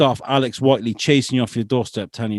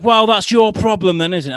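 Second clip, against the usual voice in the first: defiant retort; 10 semitones higher, 7 dB louder than usual. Retold or imitated. Retold doorstep row, his retort.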